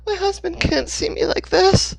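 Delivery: very high, loud hysterical wail; panicked, near tears; raving panic. Acted. Hannah raves to Dixon while peeling off her clothes, hysterical.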